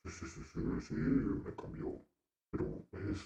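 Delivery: soft, voiceless eerie processed voice; sinister voice effect. Pretend. Host testing his changed voice with production, sinister effect. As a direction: speak softly through a creepy voice changer. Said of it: "es algo tenebrosa".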